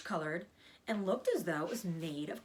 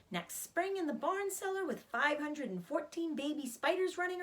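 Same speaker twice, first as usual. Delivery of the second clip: higher and louder, bubbling with hope; eager reassurance; 8 semitones higher, 3 dB louder than usual. Acted. Wilbur cheering up sad Charlotte: eager, excited. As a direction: lift her spirits, bright and eager.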